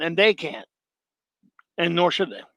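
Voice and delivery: usual speaking voice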